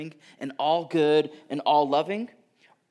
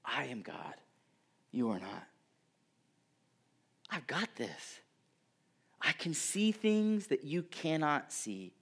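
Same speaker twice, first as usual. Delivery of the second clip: whispered, calm reassurance; hushed voice of God; 7 dB quieter than usual. Retold or imitated. God quietly reassuring the worried listener, in a whisper. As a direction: breathe it softly like a secret. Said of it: "God is saying".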